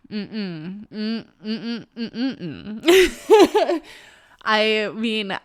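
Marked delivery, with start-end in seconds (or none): 0.1-3.8 hummed "mm-mm", climbing into a laugh; string of nuh-uh hums, breaking up laughing; dismissive hummed no. Real, not acted. Telling listeners they aren't done, playful hummed refusal.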